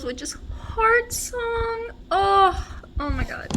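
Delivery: high, soft, drawn-out emotional squeal; in their feels. Real, not acted. Naming the book finished last night, overcome. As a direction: stretch it out high and small, overcome. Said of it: "put me super in my feels".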